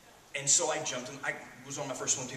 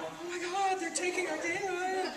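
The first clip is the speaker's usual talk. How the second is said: much higher, slow, whiny mock fret; mock Hollywood panic; slower than usual. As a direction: parody the pampered crew's alarm. Mocking Hollywood crew's panic.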